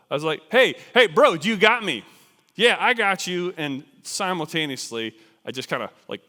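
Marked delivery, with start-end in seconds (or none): 0.5-2.0 high, loud call up to a climbing partner; retold panicky yell; urgent shout. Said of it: "I shout up quick to, to Bill". 2.5-3.5 raised, louder answer called back; high, loud retold shout; shouted reply. Partner shouts back from above.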